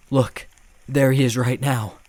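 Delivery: loud terrified scream. A narrator's reading. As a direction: scream a warning in fear. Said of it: "I screamed at the man".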